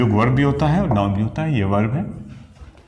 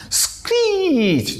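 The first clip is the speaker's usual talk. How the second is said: very high, slow "screech" falling away; imitated screech; much slower than usual. Shown as a demonstration. Teacher showing students what screech means, in a high mock screech. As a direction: draw the word out like a screech. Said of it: "जोर से चीखना".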